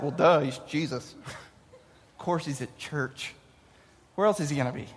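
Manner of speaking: low, quiet "well, duh" aside; mock matter-of-fact. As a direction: drop the voice, state the obvious. Mock obvious hindsight reaction.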